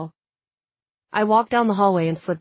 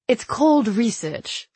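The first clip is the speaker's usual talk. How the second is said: slow, higher, self-satisfied drawl; smirking; 6 semitones higher, much slower than usual. A narrator's reading. Noah explains how he got her number, smirking. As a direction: savour the line with a smirk. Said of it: "I could hear him smirking".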